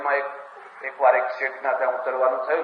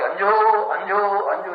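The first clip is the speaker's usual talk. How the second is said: calling out; 6 semitones higher than usual. Retold or imitated. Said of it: "પછી બૂમ પાડી".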